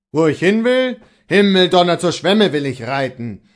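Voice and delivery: loud outburst, high at first; indignant retort. A narrator's reading. Servant answers the bailiff who grabbed him, loud indignation. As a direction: curse and answer back loudly.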